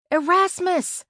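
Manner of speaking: high, loud shout of a name; calling out. A narrator's reading. Searching the woods for a missing friend, calling out high and loud. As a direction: call out loudly for someone lost. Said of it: "I called".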